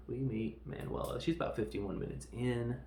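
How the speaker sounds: usual speaking voice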